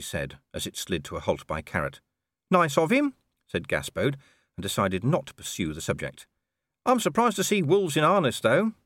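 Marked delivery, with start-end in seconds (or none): 2.5-3.1 high wry little dog voice; small dog voice. Acted. Gaspode remarks. 6.8-8.7 high shrugging dog voice; small dog voice. Acted. Gaspode remarks.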